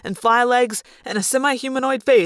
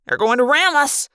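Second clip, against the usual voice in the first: higher-pitched warning; alarmed warning; 5 semitones higher than usual. A narrator's reading. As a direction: warn the crew of danger. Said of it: "Marco warned".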